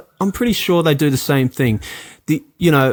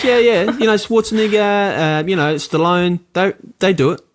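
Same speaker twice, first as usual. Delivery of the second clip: imitating the teacher; 6 semitones higher than usual. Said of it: "She goes".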